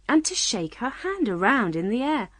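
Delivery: ordinary delivery, nothing marked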